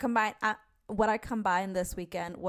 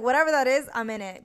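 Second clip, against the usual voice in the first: excited; 4 semitones higher, 7 dB louder, faster than usual. Retold or imitated. Excited reply to the name.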